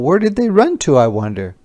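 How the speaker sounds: ordinary delivery, nothing marked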